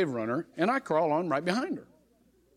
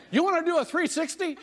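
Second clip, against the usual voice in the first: put-on high, thrilled kid voice with a laugh; pitched way up, giddy and eager; excited girl; 12 semitones higher, 4 dB louder than usual. Girl on the wave runner eagerly offering a spin, high and giddy. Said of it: "she said".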